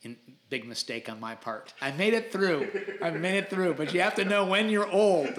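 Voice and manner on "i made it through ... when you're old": higher and louder, triumphant with a laugh behind it; proud and excited. Real, not acted. Telling the host about the Spartan Sprint with his sons, excited. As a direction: crow about surviving the race. Proud of finishing obstacle course.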